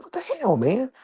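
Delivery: retold outrage, jumping way up in pitch; what-the-hell disbelief at the mess; exasperated. Finding trash on his patio.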